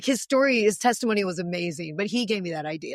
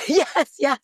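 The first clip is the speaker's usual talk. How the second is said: loud, high, thrilled; overjoyed yes yes; joyful yes; 7 semitones higher, 8 dB louder than usual. Retold or imitated. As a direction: burst out with joy. Joyful shout of acceptance.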